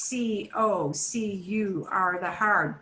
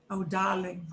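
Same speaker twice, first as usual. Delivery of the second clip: mock British accent, R dropped; lightly put-on British voice, softly R-less; 4 dB quieter than usual. Teacher imitating British speakers, British accent with dropped R. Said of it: "that, that British R".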